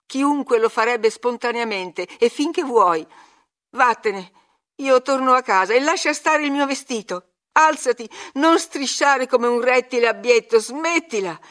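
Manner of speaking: loud, high, scornful commands; furious outburst. A narrator's reading. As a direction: order him off angrily. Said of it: "gridò Catherine fuori di sé".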